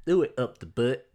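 smooth lisping ladies' man. Retold or imitated. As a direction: lisp it like a smooth player. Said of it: "I think about the ladies' man".

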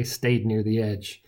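ordinary delivery

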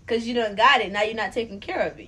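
熱く強調して; fired-up emphatic. Real, not acted. Heated emphatic preaching.

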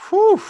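high loud whew of amazement; impressed whistle. Real, not acted. Co-host stunned by the striker's goal tally, loud whew. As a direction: let out a big astonished whew.

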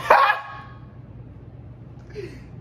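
high airy wheezing laugh; can't stop laughing at the memory; breathless laugh. Real, not acted. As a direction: lose it in a silent wheeze. Laughing at man grabbing stuff.

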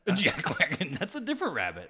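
high, loud, laughing. Real, not acted. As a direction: laugh the words out. Laughing at the name.

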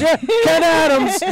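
Joey caught out, drawn-out mock reply. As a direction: drag the name out knowingly. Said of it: "And he goes".

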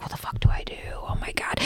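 soft unvoiced whisper of panic; whispering panic over a crying stranger; panicked whisper. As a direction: whisper it, frozen and lost. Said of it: "I'm like".

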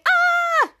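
high-pitched sustained "aah"; imitated scream. Jasper screaming in the air shaft, high imitated scream. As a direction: scream as the second falling character. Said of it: "Jasper screaming".

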